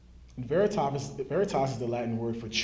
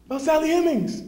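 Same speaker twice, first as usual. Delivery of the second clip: much higher and louder, "Sally Hemings" called out; calling out; 13 semitones higher, 7 dB louder than usual. Retold or imitated. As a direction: call it across the room to the guide. Calling out to the tour guide.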